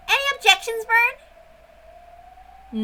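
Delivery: teasing witch. Acted. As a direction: tease Bern in a high witch voice. Witch character voiced in story.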